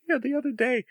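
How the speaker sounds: usual speaking voice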